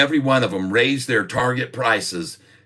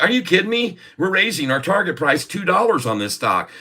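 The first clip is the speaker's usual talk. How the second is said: sharply higher, disbelieving; incredulous analyst; 8 semitones higher than usual. Retold or imitated. Oppenheimer analyst balking at the downgrade request, disbelieving. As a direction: balk at the request, voice jumping up.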